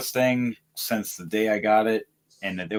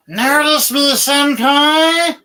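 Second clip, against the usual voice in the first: put-on high squealing anime girl; overacted anime fan squeal; overacted anime dub; 11 dB louder, much slower than usual. Anime fan call-out bit.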